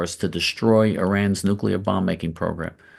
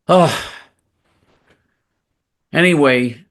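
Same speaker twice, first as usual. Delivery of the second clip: normal talking voice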